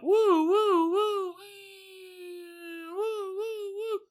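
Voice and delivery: high wailing woo woo of an ambulance siren; siren imitation. Mimicking the ambulance siren as it pulls up, high wail.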